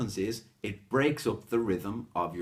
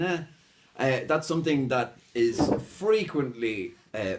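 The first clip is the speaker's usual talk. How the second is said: usual speaking voice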